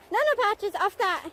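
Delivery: high, loud "no, no" to the dog; firm call-off. Real, not acted. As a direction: stop the dog before he jumps in. Stopping dog at pond.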